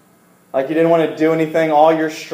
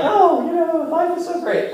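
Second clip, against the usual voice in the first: mock child voice, much higher; high singsong of a happy child; carefree little kid; 13 semitones higher than usual. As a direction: play your five-year-old self without a care in the world. Child self before the scare.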